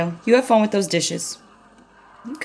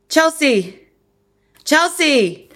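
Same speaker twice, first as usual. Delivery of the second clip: calling out; 7 semitones higher, much slower than usual. Acted. Calling daughter from another room.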